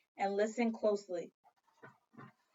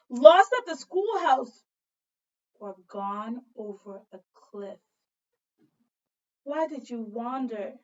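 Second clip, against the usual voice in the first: ordinary delivery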